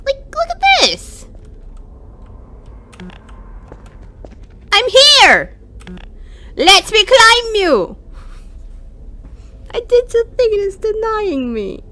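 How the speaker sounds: high-pitched protest, loudly stretched; exasperated wail. Real, not acted. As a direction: wail at the game in frustration. Game refuses to let them climb.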